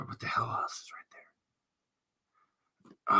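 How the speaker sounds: whispered to self. Real, not acted. Searching the shelf, whispered. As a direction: mutter it under your breath.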